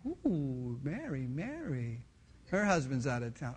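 low, soft, slow ogling; leering. Acted. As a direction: savor the sight, voice dropped low. King ogles women from roof.

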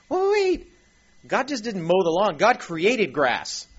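high, loud interruption, then emphatic; sudden realization. Acted. Preacher acting out a listener struck by creation, loud high astonishment.

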